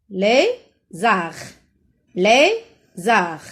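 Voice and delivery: "Lézard" split lé-zard, gap between; two drawn-out beats on "Lézard"; syllable by syllable. Shown as a demonstration. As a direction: sound out "Lézard" for pupils. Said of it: "Dans les ou bien zar".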